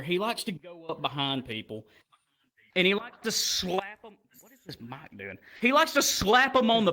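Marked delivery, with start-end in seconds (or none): none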